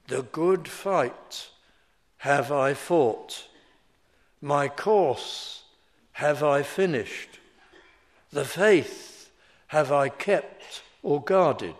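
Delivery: slow, "The good fight" fronted and stressed, "have I fought" after; Greek word order. Shown as a demonstration. Preacher reading Paul in Greek word order, slow and weighted. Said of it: "the Greek word order is rather this".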